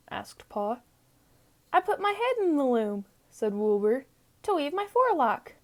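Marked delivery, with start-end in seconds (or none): 1.7-3.1 put-on high, quick, cheerful young sheep voice; Wilbur the lamb. 4.4-5.5 put-on high, quick, cheerful young sheep voice; Wilbur the lamb.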